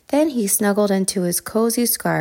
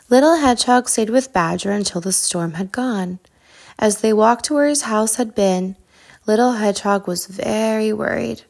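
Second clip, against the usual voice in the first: plain reading voice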